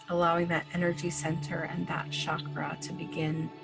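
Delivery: ordinary talk, nothing marked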